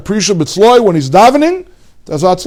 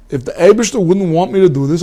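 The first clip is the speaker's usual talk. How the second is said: ordinary delivery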